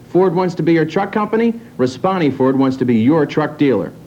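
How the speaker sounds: ordinary talk, nothing marked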